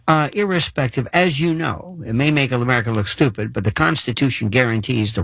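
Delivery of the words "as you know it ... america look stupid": ordinary delivery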